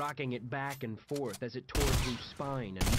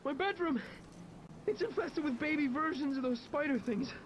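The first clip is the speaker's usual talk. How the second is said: high, breathless panicked report; out of breath; 13 semitones higher than usual. A narrator's reading. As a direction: gasp it out, winded from running. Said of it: "struggled to catch my breath".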